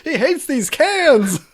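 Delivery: high, slow indignant shout; The Jerk quote. Retold or imitated. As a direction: bellow it, stretched out. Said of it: "call back to the jerk".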